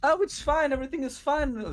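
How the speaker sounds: all happy. Acted. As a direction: sing it out happily. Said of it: "all happy".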